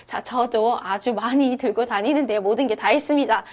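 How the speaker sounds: normal talking voice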